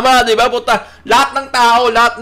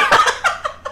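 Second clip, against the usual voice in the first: high burst of laughter; 3 dB quieter than usual. Real, not acted. Laughing at his own joke.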